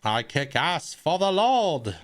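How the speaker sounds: quoting a character. Retold or imitated. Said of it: "he's like".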